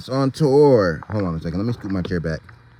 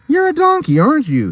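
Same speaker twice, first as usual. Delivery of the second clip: high, louder eager zebra; 3 dB louder than usual. Acted. Zebra meets the donkey, high eager voice. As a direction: greet the donkey with bright curiosity. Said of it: "he said".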